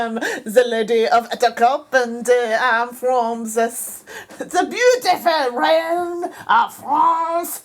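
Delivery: hammed-up French accent, slowing grandly. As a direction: drag it out in mock French. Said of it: "like a French accent or something".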